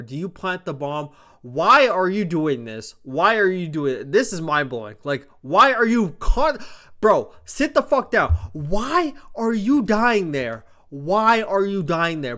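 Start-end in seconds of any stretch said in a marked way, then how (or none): 1.4-12.4 loud, higher, exasperated questioning; furious rant. Real, not acted. To the player who planted the bomb, furious and loud. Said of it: "I was so fucking furious watching this".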